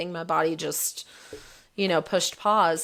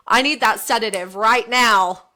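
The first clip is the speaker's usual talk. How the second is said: louder, pressing demand for the sedative; urgent demand; 3 semitones higher, 8 dB louder than usual. Retold or imitated. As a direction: insist loudly, half laughing at herself. Demanding sedative during surgery.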